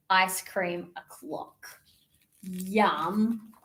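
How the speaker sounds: ordinary delivery, nothing marked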